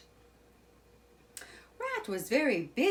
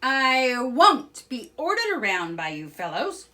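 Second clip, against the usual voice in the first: loud, stubborn protest; indignant cry; 6 semitones higher, 10 dB louder than usual. A narrator's reading. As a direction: refuse to be bossed about. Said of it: "cried Toad".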